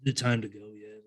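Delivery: low, soft, sleepy mumble; half-asleep and wanting to leave, retold; nodding off. Ghost hunter at three in the morning, drowsy. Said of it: "nodding off".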